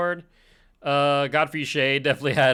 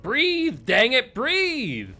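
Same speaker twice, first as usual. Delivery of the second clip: urgent yell; 10 semitones higher, 6 dB louder, much slower than usual. Retold or imitated. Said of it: "My body's like".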